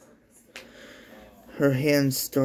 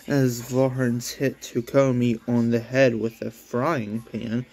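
plain reading voice

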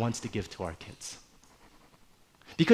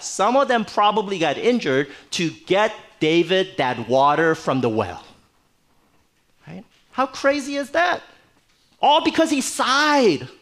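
ordinary delivery

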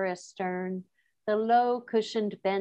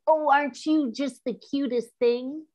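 put-on higher, sing-song baby-talk coo; cooing at something cute; 5 semitones higher, 3 dB louder than usual. Teacher mocking a crush who calls you cute. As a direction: gush over him like a cute pet. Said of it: "they go".

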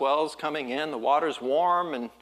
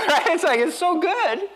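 high, laughing, slow delight; giddy delight; 8 dB louder than usual. Real, not acted. Preacher to congregation, gushing with laughing delight. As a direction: gush about a perfect surf day. Joyful praise of surfing.

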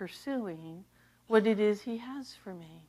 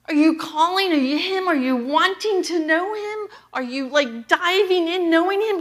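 higher and louder, pressing each question home; full-force preaching; 7 semitones higher, 6 dB louder than usual. Acted. Pastor urging the congregation to know God, preaching full force. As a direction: preach it hard and climbing, question after question.

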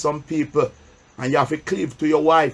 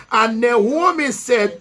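slow, raised reading of the Bible verse; solemn pulpit Bible reading; scripture reading; 5 semitones higher, slower than usual. Acted. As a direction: read the verse slowly to the church, pausing for answers. Preacher reads scripture aloud.

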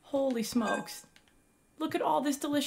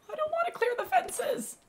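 cracking whiny whine; 14 semitones higher than usual. Real, not acted. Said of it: "Oh, my voice sounds awful".

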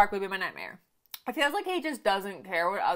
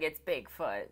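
lightly imitated nineties announcer; 6 semitones lower, 5 dB quieter, slower than usual. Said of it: "Be like".

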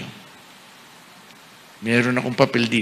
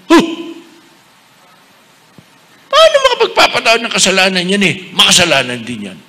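fast, scornful mock of the doubters, higher and louder; scoffing critics; 5 semitones higher, 5 dB louder, faster than usual. Bishop mimicking scornful critics of priests. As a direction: sneer it like a doubter. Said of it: "sabi ng iba".

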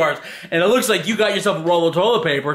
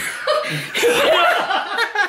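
high laugh; 12 semitones higher than usual. Real, not acted. Grandson cracks up.

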